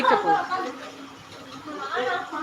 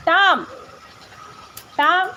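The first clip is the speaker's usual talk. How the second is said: calling out; 7 semitones higher, much slower than usual. Real, not acted. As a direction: call the pet's name sharply, raised in pitch. Calling Tom to come here.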